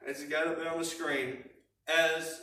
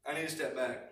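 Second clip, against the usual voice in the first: softer echo of I need to step back, said to oneself; softer repeat; 5 semitones lower, 6 dB quieter, faster than usual. Shown as a demonstration. Preacher showing how to calm yourself, said softly to himself. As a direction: repeat it quietly, calming yourself down. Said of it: "and say".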